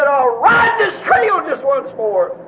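slow, shouted proclamation; retold scream. Preacher retells the rider's last cry from horseback, slow commanding shout. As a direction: imitate a rider crying out, stretching each word.

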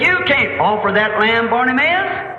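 high, rising protest, drawn out at the end; challenging priest. Retold or imitated. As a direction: challenge him as the priest, building to a shout. Priest challenges blind man in story.

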